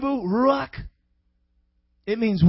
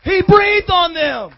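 higher, slow proclamation; raised emphatic repeat; 7 semitones higher, 5 dB louder, slower than usual. Real, not acted. Preacher driving home the breath on disciples, high drawn-out proclamation. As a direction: ring it out slowly to the room.

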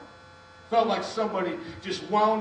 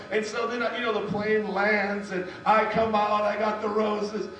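ordinary delivery

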